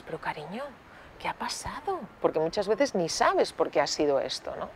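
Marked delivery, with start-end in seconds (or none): 0.0-2.0 put-on hushed, gentle question to a child; tender and much softer; soft soothing parent. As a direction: ask very softly, like comforting a little one. Parent soothing child after tantrum.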